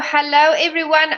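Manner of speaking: usual speaking voice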